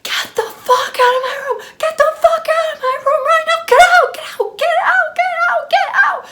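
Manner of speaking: high-pitched, loud screamed imitation; retold screaming. Woman ordering intruders out of her room, screaming. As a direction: re-enact yelling them out, shrill-high and repetitive. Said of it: "I'm screaming at them".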